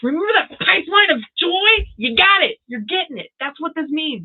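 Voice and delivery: high, excited rush of good news. Real, not acted. Reader tells Cindy her joy is coming, so excited. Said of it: "I get so excited".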